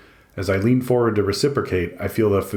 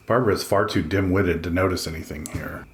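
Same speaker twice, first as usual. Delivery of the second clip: plain reading voice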